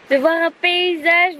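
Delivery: usual speaking voice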